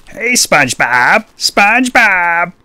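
high, slow, drawn-out calls for SpongeBob; Squidward impression. Calling a neighbour over as a cartoon squid, high and slow. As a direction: stretch each call out high like a cartoon squid. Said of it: "That's my Squidward impression".

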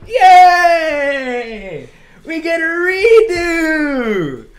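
high, slow mock-joyful yell; high drawn-out fake celebration; sarcastic high cheer. Real, not acted.

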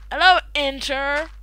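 normal talking voice

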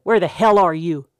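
loud, furious yell; angry shout. Acted. Angry suitor hunting for her outside, furious loud yell. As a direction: bark it out, fed up with searching. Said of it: "someone shouted".

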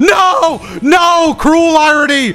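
high, loud laughing protest; shouting no. Real, not acted. Gamer laughing at the ironic death, loud shout. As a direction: cry out in mock despair.

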